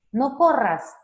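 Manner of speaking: loud scolding shout. Shown as a demonstration. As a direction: yell it like a cross parent. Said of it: "Con un tono de voz gritando". (grito de regaño)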